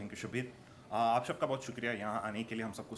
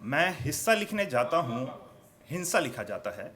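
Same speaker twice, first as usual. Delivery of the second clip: declaimed verse with falling line ends; poetry recitation; 4 semitones higher than usual. Acted. Poet repeats the couplet for the audience, declaimed recitation.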